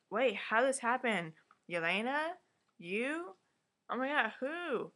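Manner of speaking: acted astonishment. Recapper as Asha learning of the job, mock astonishment. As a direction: imitate someone stunned, pitching each question up.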